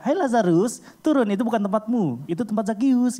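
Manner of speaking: high, loud call up a tree; bluffing Sunday-school teacher. Acted. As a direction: order him down, high and insistent. Acted teacher calling up again. (guru sekolah minggu berseru, nada tinggi)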